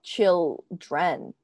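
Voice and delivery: put-on "children" very slow, split into "chil" and "dren"; sounded-out word. Shown as a demonstration. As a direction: sound out "children" in two drawn-out parts.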